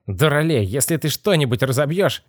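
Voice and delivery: exasperated scolding. Acted. Boss warning the boy who fell in, exasperated.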